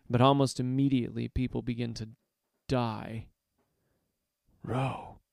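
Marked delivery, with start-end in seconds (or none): 4.6-5.2 soft, hushed call; whisper. A narrator's reading. Narrator seeking Ro in the crowd, whispering. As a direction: say the name under the breath. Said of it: "I whisper".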